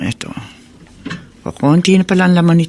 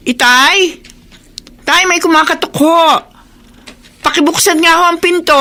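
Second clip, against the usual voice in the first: calling out; 12 semitones higher than usual. Acted. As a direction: call to father in another room. Calling father across house. (tumatawag nang malakas sa ama)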